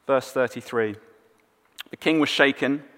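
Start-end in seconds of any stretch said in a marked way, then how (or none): none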